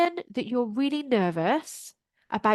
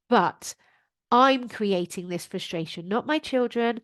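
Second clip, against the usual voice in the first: ordinary talk, nothing marked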